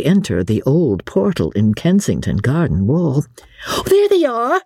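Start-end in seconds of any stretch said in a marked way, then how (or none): 3.7-4.6 put-on high, eager cry of a young girl; lightly voiced Polly, high and thrilled; excited girl. A narrator's reading. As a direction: spot them and squeal it out. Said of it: "said Miss Polly".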